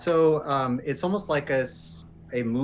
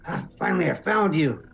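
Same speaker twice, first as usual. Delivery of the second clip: higher, wounded character voice; imitated character voice; 5 semitones higher, 3 dB louder than usual. Wounded traveler stumbles out to the armed group, imitated voice, strained. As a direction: call out as the injured man stumbling in. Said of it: "my best interpretation of his voice".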